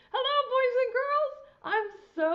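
very high, fast squeaky bird voice; puppet bird voice. Acted. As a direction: chirp it out like a tiny bird. Puppet voice greets the kids.

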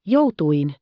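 urgent, hurried butler call. A narrator's reading. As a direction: hurry her in, calling out. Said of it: "huusi hän kiirehtäen". (kiirehtivä huuto)

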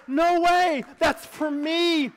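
loud, high astonished cry; can't believe it's for you; thrilled gasp of joy; amazed disbelief. Acted. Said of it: "And you're like".

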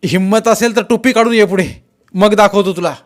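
high, loud challenge over a crowd; shouted dare. A narrator's reading. Jaydeep dares the masked man to unmask, shouting. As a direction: dare him forward, shouting to be heard. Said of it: "ओरडल्याशिवाय बोलताच येत नव्हतं".

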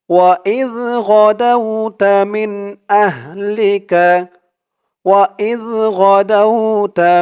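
slow melodic Quranic recitation. Shown as a demonstration. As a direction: chant the verse with tajweed. (ধীর সুরেলা কুরআন তিলাওয়াত)

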